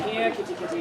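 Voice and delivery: hushed pet-calling voice; calling kittens. Acted. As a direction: coax little kittens softly. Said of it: "She calls the kittens to her".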